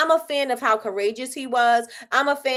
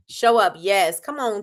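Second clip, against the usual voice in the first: ordinary delivery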